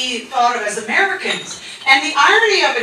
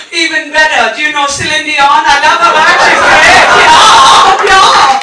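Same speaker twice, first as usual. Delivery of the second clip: put-on excited German accent, building much louder; German man's accent; 8 dB louder than usual. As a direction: gush as a mock German fan, accent thick. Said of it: "And he's like".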